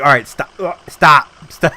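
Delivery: half-laughing shout telling him to quit; mock yell to stop. Friend cutting him off, mock yelling stop.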